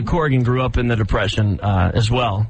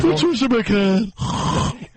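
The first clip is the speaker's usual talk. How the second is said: high, very slow name drop; Patricia McCann; 8 semitones higher, much slower than usual. Retold or imitated. Host playing her relaxing by name, high drawn-out voice. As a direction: stretch the name out in parody. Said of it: "By saying my name".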